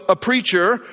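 normal talking voice

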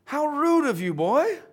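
scolding dad. Acted. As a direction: send your boy to his room. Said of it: "his dad stands up, says".

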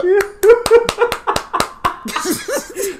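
high wheezing laugh; long high laugh. Real, not acted. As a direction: let the laugh roll out. Cracking up at ketchup story.

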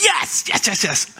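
gleeful yes, the first one jumping high; spotting the storm cloud with mock delight; excited yes. Father cheering the storm cloud, playfully elated. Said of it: "and I'm like".

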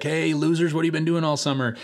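high, breezy ribbing; joking jeer. Retold or imitated. Roommate comes back from summer cracking jokes, jeering. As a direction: imitate a cocky roommate's teasing greeting. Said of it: "cracks some jokes".

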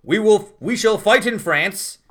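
slow, loud, grand speech delivery; dramatic reading. Shown as a demonstration. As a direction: declaim it like a wartime leader. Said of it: "I'll give you a dramatic reading".

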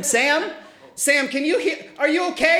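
calling out. Acted. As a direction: call out for Sam. Calling out to Sam.

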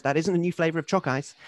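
ordinary talk, nothing marked

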